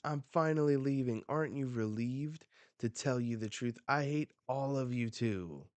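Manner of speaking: hammed-up resentful declaration; acted bitter outburst. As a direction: play an angry kid storming off. Loki's bitter farewell outburst.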